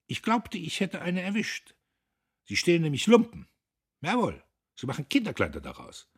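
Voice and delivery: high, loud explaining; lecturing. A narrator's reading. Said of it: "Er belehrte Guste".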